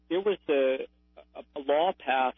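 ordinary delivery

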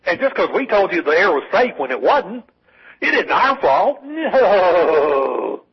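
high, swooping mock whine with a drawn-out no; mock whining. Caller mimicking right-wingers shrugging off blame, mock whining. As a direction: whine it loftily in imitation, stretching the last words. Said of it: "right-wingers absolutely just whining".

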